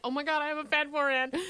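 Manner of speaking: small, high mock panic; hushed, squeaky self-pitying freak-out; mock spiral. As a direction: act out a quiet little meltdown. Acting out her future spiral.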